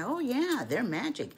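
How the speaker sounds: high-swooping grandmotherly yes; lightly acted grandma talking to a little one; playful assurance to child. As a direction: humour a small child's wonder. Grandmother humours child in story.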